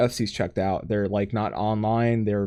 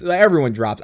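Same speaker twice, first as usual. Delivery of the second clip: ordinary talk, nothing marked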